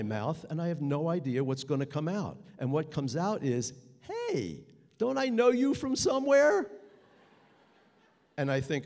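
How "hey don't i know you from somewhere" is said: high, loud, panicky pickup line; nervous blurt. Retold or imitated. Confronting a knife-wielding man, high nervous blurt.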